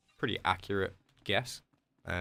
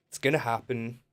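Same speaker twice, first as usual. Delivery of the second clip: mid-stretch; 3 semitones higher than usual. Real, not acted. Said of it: "a really nice stretch".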